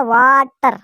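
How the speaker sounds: वाटर drawn out slowly as वा, टर; split into syllables. Shown as a demonstration. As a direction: stretch वा, then a separate टर in वाटर. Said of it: "वाह हो गया syllable और टर भी साइलेबल". (वाटर को धीरे, वा-टर तोड़कर)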